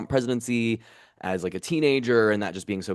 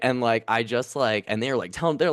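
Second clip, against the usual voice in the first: normal talking voice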